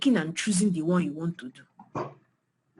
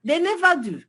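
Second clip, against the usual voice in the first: emphatic; 10 semitones higher, 5 dB louder than usual. Real, not acted. Bitter emphatic repeat.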